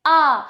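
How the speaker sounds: आ said loud with the mouth wide open; a long open आ, held; drawn out aa. Shown as a demonstration. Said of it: "इसका उच्चारण करते समय हमारा मुख पूरा खुलना चाहिए".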